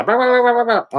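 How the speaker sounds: high-pitched mocking blah-blah babble; mock in-your-face yapping. Man imitates someone getting in his face, high mock yapping. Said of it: "get in my face and go".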